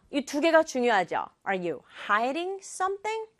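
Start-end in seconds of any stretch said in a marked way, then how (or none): none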